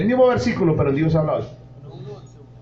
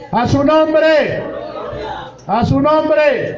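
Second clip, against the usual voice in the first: louder and higher, building to shouted lines; preaching at full force; 9 semitones higher, 3 dB louder than usual. Acted. Pastor rousing the congregation about joyful faith, building to a shout. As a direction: preach it up until you're shouting.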